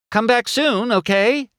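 very high-pitched farewell call. A narrator's reading. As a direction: call after departing visitors. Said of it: "Sally called as the couple sped off".

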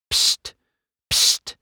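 sharp secretive "psst"; hushed psst. A narrator's reading. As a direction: get their attention on the sly. McQueen secretly beckons the couple.

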